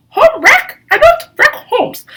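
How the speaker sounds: high, loud squawk of disbelief; indignant outburst. Acted. Woman protesting she is no home wrecker, indignant outburst. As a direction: shriek it in mock outrage.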